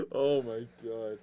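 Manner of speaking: low, soft, spent after laughing; tearing up from laughter. Real, not acted. Friend recovering from a hilarious bit, tearing up. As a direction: recover slowly from a laughing fit, real. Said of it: "I'm literally tearing up".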